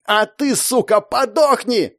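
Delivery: high, slow vengeful yell; yelling in fury. A narrator's reading. Enraged girl aiming a staff at her enemy, yelling. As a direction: hurl it as a death curse. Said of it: "завопила она".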